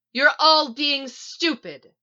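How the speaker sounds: high, loud, drawn-out roar; furious, emphatic shout. A narrator's reading. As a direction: bellow at the whole room. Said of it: "she roars".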